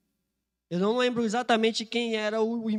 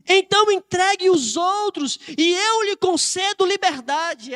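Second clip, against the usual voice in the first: louder, higher acted demand; insistent bargaining; 9 semitones higher, 8 dB louder than usual. Centurion offering Maria Madalena freedom for the others, insistent. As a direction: press the offer forcefully, as an interrogator.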